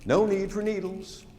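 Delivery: ordinary delivery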